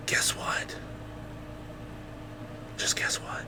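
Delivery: hushed conspiratorial whisper; whispered guess what; secretive whisper. Acted. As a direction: lean in and whisper the tease. Secretive teasing before big news.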